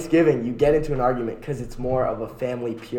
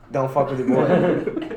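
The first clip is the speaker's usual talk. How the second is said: laughing; slower than usual. Real, not acted. Laughing defense of Macy's parade.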